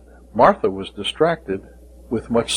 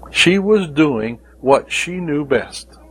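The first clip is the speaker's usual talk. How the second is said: ordinary delivery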